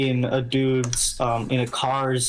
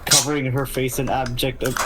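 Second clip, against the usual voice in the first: usual speaking voice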